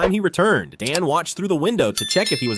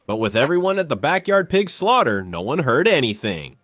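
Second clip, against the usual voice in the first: usual speaking voice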